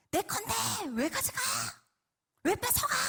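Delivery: high, squealed mock indignation; whiny protest. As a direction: protest like a child whose toy is taken. Acted childish protest at losing it.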